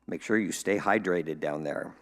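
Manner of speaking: ordinary talk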